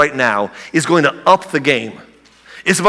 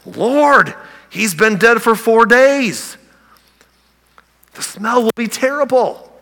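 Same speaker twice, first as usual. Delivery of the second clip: higher, pleading protest; protesting sister; 7 semitones higher, slower than usual. Acted. Martha protesting to Jesus at the tomb, higher alarmed voice. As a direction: push back on him, alarmed.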